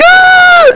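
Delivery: sudden high piercing yell, imitated; retold scream. Sparring kid suddenly screams after a side kick, high mock yell. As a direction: let out a shrill mock scream of pain. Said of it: "He's like".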